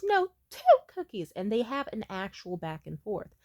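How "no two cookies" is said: put-on high, louder, insistent child voice; bargaining little boy. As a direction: imitate a stubborn kid haggling. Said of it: "He says".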